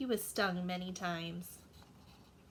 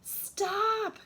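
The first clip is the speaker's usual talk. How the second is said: high, loud cry of "Stop!"; cried out; 10 semitones higher, 5 dB louder than usual. Acted. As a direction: cry out to halt the noise. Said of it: "cried the mouse".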